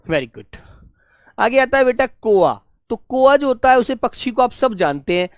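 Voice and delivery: ordinary delivery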